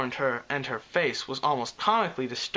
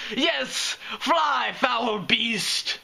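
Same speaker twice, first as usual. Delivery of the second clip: much higher, hammy dramatic cry; hot declaration; 8 semitones higher, much slower than usual. Acted. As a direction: banish the beast theatrically. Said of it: "the unicorn declared, hotly".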